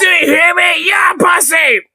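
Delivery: high-pitched yelling parody; mock loud shouting. Streamer mimics man in clip, hammed-up high shouting. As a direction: shriek it like the ranting man. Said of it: "Dude, he's so loud".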